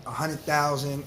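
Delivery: ordinary talk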